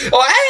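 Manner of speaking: high-pitched imitation shriek; mock girlish scream. Reviewer imitating the whiny IT guy, girlish mock scream. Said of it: "Screaming like a little fucking girl".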